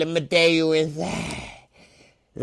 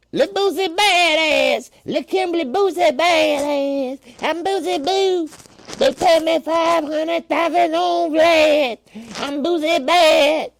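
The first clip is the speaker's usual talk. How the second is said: [name] impression; 12 semitones higher, 3 dB louder than usual. Doing [name] for the chat, high and loud. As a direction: squeak it out like [name] showing off. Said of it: "That was the new remix".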